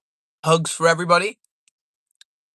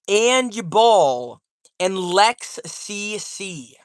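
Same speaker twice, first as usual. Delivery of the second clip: slow drawn-out names; much slower than usual. Real, not acted. Slowly reading member names.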